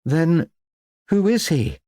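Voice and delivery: high, halting question; puzzled demand. A narrator's reading. Vicar puzzled by the unknown corpse.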